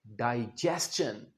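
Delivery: डाइजेशन low and soft, "dai-jest-chun"; low, soft डाइजेशन to repeat; model pronunciation. Shown as a demonstration. Teacher models the word for students: low soft डाइजेशन. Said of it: "क्या बोलें?".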